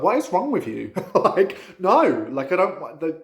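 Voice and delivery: incredulous scolding of a bored spirit; exasperated retort. Retold or imitated. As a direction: throw it back in disbelief. Scolding her spirit in a retold quarrel.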